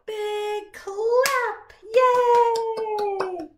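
high, loud joyful cheer; big cheer. Real, not acted. Teacher cheering the class after the song, loud cheer.

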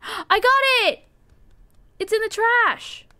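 high, loud gasping triumph; excited discovery. Real, not acted. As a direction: burst out with joy at finding it. Finds toothbrush in trash.

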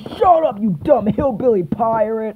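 high, sharp insult; angry snap. Acted. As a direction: snap at the pirate. Snapping at pirate.

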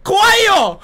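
high, loud, drawn-out scream full of laughter; laughing mock scream. Real, not acted. Streamer laughing at nothing happening, mock scream. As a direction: shriek in fake terror while laughing. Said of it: "こういう怖さ".

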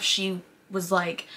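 ordinary talk

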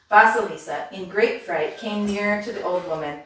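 usual speaking voice

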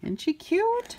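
high, soft cooing question; cooing aside. Real, not acted. Reader coos to the child about the donkey.

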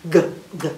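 hard "g" from the back of the throat; isolated "g", a throaty hard stop. Shown as a demonstration. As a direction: say "g" as its hard sound. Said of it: "The hard sound is in the back of your throat".

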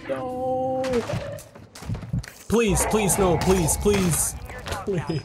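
high desperate pleading; anguished denial. Real, not acted. Begging not to die.

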